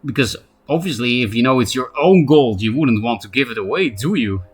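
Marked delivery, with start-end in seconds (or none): none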